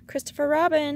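high, loud stage call; voiced stage whisper, pitched high. A narrator's reading. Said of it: "he said in a loud whisper".

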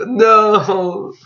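a long, pleading no; mock dismay. Broke gamer told he still owes money, mock dismay. Reacting to bad news.

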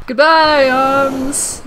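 drawn-out hammed-up goodbye; mock farewell. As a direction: call goodbye loudly, then ease down.

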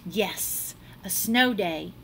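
plain reading voice

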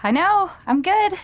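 high, playful self-congratulation; pleased boasting. Acted. Giver of a surprise album to a friend, proudly excited. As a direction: show off happily about your gift. Proud of the gift just given.

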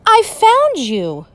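slow, high, grinning joy; happy cry. Acted. George finally finding the Yeti, slow and grinning. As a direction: savour the discovery. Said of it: "cried George with a big happy grin".

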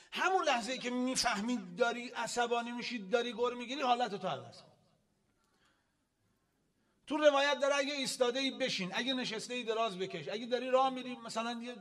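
loud, high-pitched sermon instruction; forceful preaching. Real, not acted. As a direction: drive the advice home to the congregation. Preaches at raised volume.

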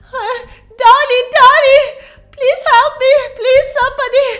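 screaming for help. Acted. Leah fleeing Bendy, screaming to Donnie for help. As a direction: scream for your husband to save you.

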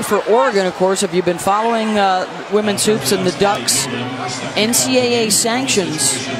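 ordinary talk

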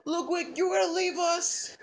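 high-pitched dismayed question; high, childlike disbelief. Acted. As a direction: plead as if being abandoned. Child learns carer is leaving.

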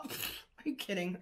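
ordinary talk, nothing marked

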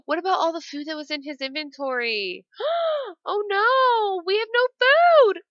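gasping, high, wailing dismay; mock panic. Gamer finds the Sims' food is gone, mock-panicked high wail. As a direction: build to a loud, hammed-up cry.

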